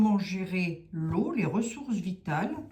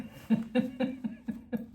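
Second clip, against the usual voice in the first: breathy, wheezy burst of laughter; amused giggling fit; laughing; 6 semitones higher than usual. Real, not acted. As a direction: break into a run of chuckles. Amused by what the cards show.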